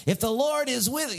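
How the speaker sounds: high, whining protest; hammed-up, high-pitched whine; whiny. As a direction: whine it like a put-upon child. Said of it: "he kinda gets all whiny".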